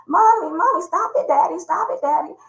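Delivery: put-on high child's pleading; crying children. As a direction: kids begging daddy to stop. Crying kids begging father.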